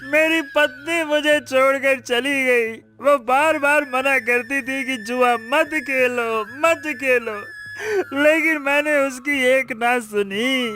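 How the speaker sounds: tearful, stretched final vowels; weeping. Acted. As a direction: wail over your own ruin. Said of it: "इस तरह रोते देखकर".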